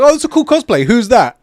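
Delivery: squealing imitation of an excited gamer; excited fan. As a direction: gush like a fan who just spotted a cool cosplay. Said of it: "you're gonna be like".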